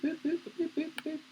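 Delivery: high, thin repeated beeping noise; mock machine beeps. Teacher switching to the models, playful high beeps. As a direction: beep like a little machine.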